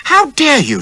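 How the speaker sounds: shrill high shocked cry, loud; squealing outrage. A narrator's reading. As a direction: squeal it like a startled lady. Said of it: "she squealed".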